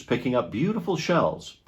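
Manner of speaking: plain reading voice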